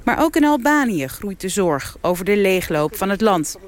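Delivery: ordinary delivery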